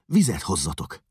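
woman ordering the maids; urgent call. A narrator's reading. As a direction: call for water in a hurry. Rába orders water.

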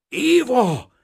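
high, loud call full of dread; terrified cry. A narrator's reading. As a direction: cry out her name in terrible inner fear. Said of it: "kiáltotta valami rettenetes belső ijegységgel". (rémült, kétségbeesett kiáltás)

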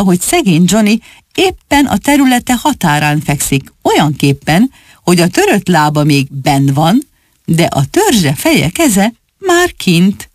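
ordinary delivery, nothing marked

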